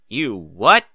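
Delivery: loud startled question; shocked. Acted. Applejack shocked at her brother sneaking off, loud. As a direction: demand it in disbelief.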